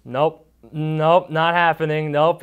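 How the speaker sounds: high, loud flat refusal. Retold or imitated. Scared boy refusing the ropes course, a loud high refusal. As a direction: shoot it down in a panic.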